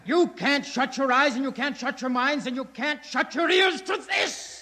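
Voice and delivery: rising to a shout; building oratory. Acted. Hoyland's building speech.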